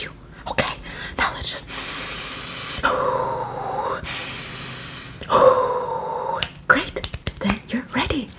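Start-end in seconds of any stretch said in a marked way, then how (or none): 1.7-6.6 long breathy sigh. Acted. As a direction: let out a long calming breath. Will you take a deep breath with me?.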